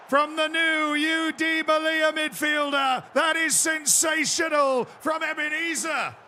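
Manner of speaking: excited commentator building to a roaring goal call; high, shouted goal commentary; live play-by-play. Pretend. Mock goal commentary on the match.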